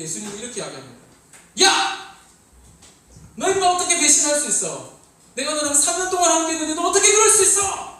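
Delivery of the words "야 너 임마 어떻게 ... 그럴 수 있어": hammed-up yelling rebuke, high and loud; mock furious Jesus. Jesus yelling at Peter for betraying him, furious shout. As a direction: rant like a betrayed teacher, full blast. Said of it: "막 이렇게 막 예수님이 흥분하신 게 아니라".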